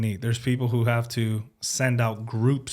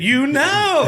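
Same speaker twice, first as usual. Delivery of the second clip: mock excited reply; 8 dB louder than usual. Mock reply to Uber driver.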